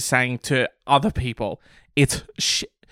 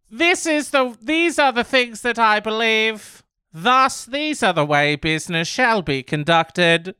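high, pompous mock business owner; 6 semitones higher than usual. Mocking the owner's stiff statement, high and pompous. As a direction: puff it up like a self-important owner. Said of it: "Who talks like that?".

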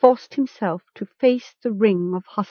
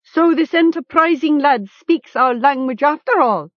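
higher-pitched mocking announcement; lightly sarcastic captor; 8 semitones higher, 4 dB louder than usual. A narrator's reading. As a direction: show up the captive before the crowd.

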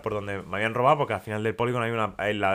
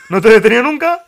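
loud laughing policeman quote; 9 semitones higher, 12 dB louder than usual. Retold or imitated. A courier retelling the police desk, loud and laughing. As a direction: repeat it much louder and higher, cracking up.

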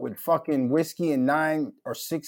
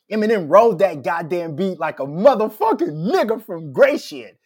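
hyped rant; 5 semitones higher, 7 dB louder than usual. Real, not acted. Hyped rant about [name]'s verse.

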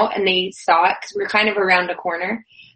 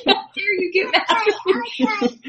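mock indignation; 10 semitones higher than usual. Mock indignation toward staff member.

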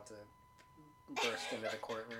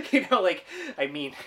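loud, high words through laughter; laughing as you pick up the thread; 18 dB louder than usual. Real, not acted. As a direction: talk on while still cracking up.

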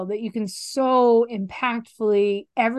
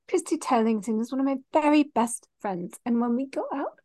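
higher-pitched mock French accent; 4 semitones higher, 3 dB quieter than usual. Mimicking a supermodel from the film, French accent. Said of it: "I can do a French accent too".

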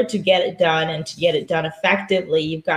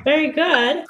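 higher, bright-eyed praise of a drill; warm praise; 8 semitones higher than usual. Real, not acted. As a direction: praise the student's effort. Praising the tongue click.

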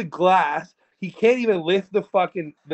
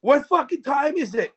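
retold yell; 10 semitones higher than usual. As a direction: act out yelling the question at a guard. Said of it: "I'm yelling at him".

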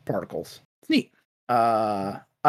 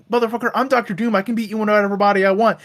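fast, higher, boastful villain rant; Doctor Doom voice; 6 semitones higher, much faster than usual. Retold or imitated. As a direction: puff up like an offended tyrant. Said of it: "And Doctor Doom goes like".

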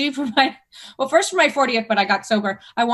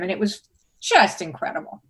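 ordinary talk

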